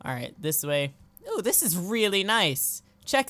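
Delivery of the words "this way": ordinary delivery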